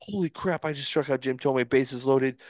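high, held-in excitement; amazed inner voice. Retold or imitated. Pitcher to himself after striking out Jim Thome, amazed. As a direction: marvel to yourself without raising the volume. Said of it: "my heart was coming out of my freaking chest".

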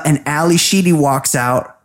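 usual speaking voice

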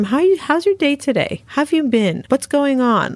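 lightly acted cheerful check-in, voice lifted; friendly greeting. Shown as a demonstration. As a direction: show how to greet a stranger brightly. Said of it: "Even ask them".

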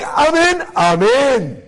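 high, fervent declaration of commitment; mock gung-ho enthusiasm, leaping up then settling; hardcore zealot. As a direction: declare it like an all-in convert. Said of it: "like, super hardcore Baalei Teshuva".

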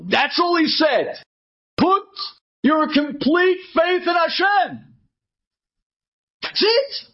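husband shouting that's all there is; insistent shout. Acted. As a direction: hammer the point home to the wife. Husband insisting to his wife.

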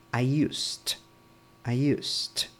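"used" ending in a crisp T; final T sound. Shown as a demonstration. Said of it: "lo pronuncias como una T al final".

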